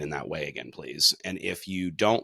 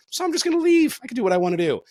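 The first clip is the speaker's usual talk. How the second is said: put-on much higher, louder defiant child; defiant kid; 6 dB louder, faster than usual. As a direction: play the bratty kid walking out. Voicing defiant kid storming off.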